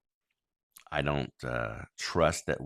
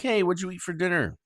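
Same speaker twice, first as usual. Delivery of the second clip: quick, much higher, upbeat ask; cheery question; 12 semitones higher, faster than usual. Retold or imitated. Dad home asking kids about dinner, quick and upbeat. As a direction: toss out a bright casual question.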